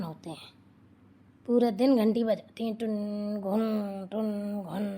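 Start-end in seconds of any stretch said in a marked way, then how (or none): 2.8-4.1 doorbell imitation. 4.1-4.9 doorbell imitation.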